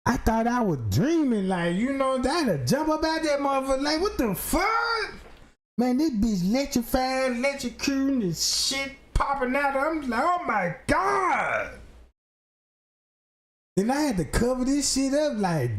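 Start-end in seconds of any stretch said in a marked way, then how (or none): 4.0-5.1 high, slow disbelieving cry; shocked yell. Retold or imitated. Man watching his clone girlfriend spark, high and shocked. 10.2-11.8 slow, stretched shout of shock; horrified cry. Retold or imitated. Man seeing the clone short-circuit, a slow shout.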